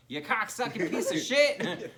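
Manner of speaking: laughing while acting the kid's insult; mock insult. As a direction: spit it out like a mad fifth grader.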